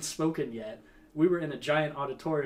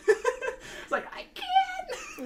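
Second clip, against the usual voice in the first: much higher, strangled squeak with no voice left; lost-voice croak; much slower than usual. Retold or imitated. Answering the teacher after shouting all day, croaking hoarsely. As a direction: try to talk with a dead throat. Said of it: "my throat just gargled".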